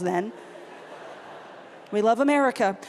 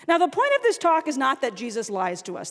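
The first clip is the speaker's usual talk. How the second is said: normal talking voice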